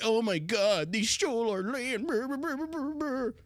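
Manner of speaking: high sneering crybaby impression; mocking whiner voice. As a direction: whine then babble like a crybaby.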